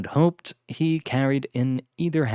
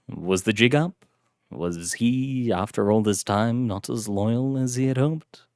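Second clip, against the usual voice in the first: plain reading voice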